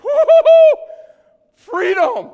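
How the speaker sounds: loud, high-pitched celebratory yell; hammed-up cry of joy at full volume; jubilant whoop. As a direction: whoop and shout it like a freed debtor. Imagined celebration of cancelled debt.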